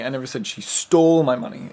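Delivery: "stole" punched out loud; stress on STOLE. Shown as a demonstration. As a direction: hit the word "stole" hard. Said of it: "seven different ways".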